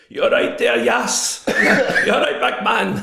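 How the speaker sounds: loud, high-pitched matey yell; big imitated street shout, drawn out; taxi driver shouting. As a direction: holler it out like a cabbie from the car window. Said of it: "shouting out the window".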